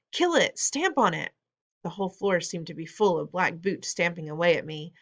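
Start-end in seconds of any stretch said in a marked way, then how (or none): 0.1-1.3 high shouted orders; shouting. A narrator's reading. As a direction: urge them on, frantic. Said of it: "they were shouting".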